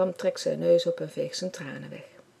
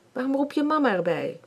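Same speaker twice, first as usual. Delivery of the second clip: angry; 8 semitones higher, 3 dB louder than usual. Acted. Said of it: "Bram wordt boos".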